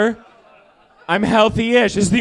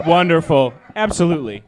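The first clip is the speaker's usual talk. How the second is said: ordinary talk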